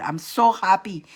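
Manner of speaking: ordinary talk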